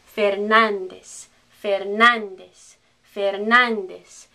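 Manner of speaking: slow Fernandez as "fehr-NAHN-des"; Spanish pronunciation. Shown as a demonstration. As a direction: end Fernandez on S, never Z. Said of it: "that's a S in Spanish".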